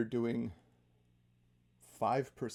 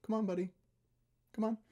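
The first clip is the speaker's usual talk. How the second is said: higher, coaxing call to a dog; 11 semitones higher, 3 dB louder, faster than usual. Retold or imitated. Owner coaxing his dog on a walk, higher and coaxing. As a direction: gently urge the dog along, pitched up. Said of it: "and then you go".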